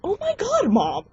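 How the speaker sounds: very high-pitched re-enacted squeal; excited outburst. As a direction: squeal it to mom in disbelief. Retold excited outburst to mom.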